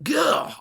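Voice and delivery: a short high frustrated "gah"; exasperated groan. Retold or imitated. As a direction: let out a burst of exasperation. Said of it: "it was just like".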